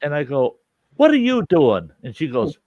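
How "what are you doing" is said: startled question. Retold or imitated. Sees ex-wife reacting, confused.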